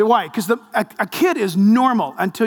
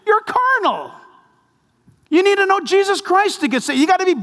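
preaching rebuke; 10 semitones higher than usual. Real, not acted. Preaching rebuke at full force.

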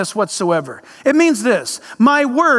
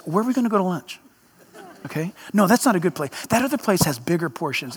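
low, soft, quick planning chatter; food-obsessed eater; 5 semitones lower, 6 dB quieter, much faster than usual. Acted. Someone living to eat planning lunch and dinner with friends, low and hurried. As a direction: rattle off meal plans under your breath.

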